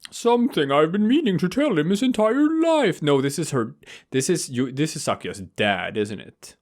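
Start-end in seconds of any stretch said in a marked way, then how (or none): 0.1-3.0 very high-pitched affected character voice; Yuya. Acted. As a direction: play it high and theatrical, far from your own voice. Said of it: "So you read it in Yuya's".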